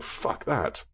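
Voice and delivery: dismissive mock zombie mutter; a lazy zombie can't be bothered with the hill; zombie shrugging it off. Speaker voicing a zombie that refuses to climb a hill, whispered dismissal.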